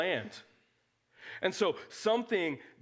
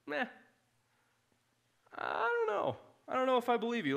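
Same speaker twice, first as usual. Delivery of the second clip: much higher, skeptical meh; doubtful Peter; 6 semitones higher than usual. Retold or imitated. Peter doubting God after the third vision, skeptical. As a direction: shrug it off, unconvinced. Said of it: "he's still like".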